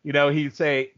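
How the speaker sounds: ordinary delivery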